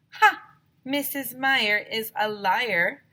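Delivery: loud, high, slow triumphant crow; triumphant gloat. Acted. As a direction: gloat like you caught her out. Gleeful accusation.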